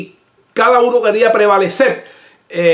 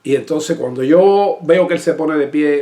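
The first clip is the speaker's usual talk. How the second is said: normal talking voice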